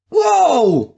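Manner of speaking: very high, loud whoa; amazed cry. Acted. The three bros watching Kim fight, high amazed whoa. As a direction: gasp out in awe. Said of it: "all three cried in synchrony".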